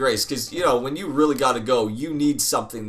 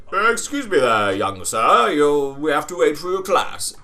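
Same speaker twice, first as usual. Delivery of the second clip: lightly voiced snooty museum worker, a bit louder; stuffy museum worker; 4 dB louder than usual. Museum worker stopping a lone kid at the door, mildly pompous. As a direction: play a pompous guard, mildly hammed-up. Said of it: "the guy's like".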